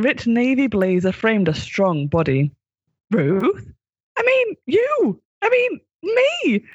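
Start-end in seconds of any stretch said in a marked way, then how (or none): 3.1-6.6 high, slow, flustered excitement; excited stutter. Acted. Doctor recognising her past self, excited and high. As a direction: stumble over yourself in delight. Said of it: "stuttered the Doctor excitedly".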